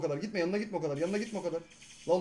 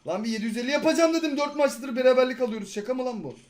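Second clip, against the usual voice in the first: loud, higher frustrated rant at a teammate; real gamer rage, louder and higher; angry rant; 5 semitones higher, 7 dB louder than usual. Real, not acted. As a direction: blow up at the teammate. Raging at teammate mid-game.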